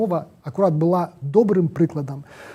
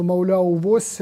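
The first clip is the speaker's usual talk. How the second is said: normal talking voice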